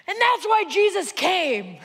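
high, loud declaration; screaming match. Acted. Norm telling the stranger of Jesus, screaming. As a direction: shout it as the clinching argument. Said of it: "they begin a screaming match".

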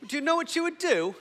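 very high, loud mock-British voice; exaggerated British accent. Shown as a demonstration. Preacher replaying his slip for the congregation, hammed-up British accent. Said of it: "kinda got British on you there".

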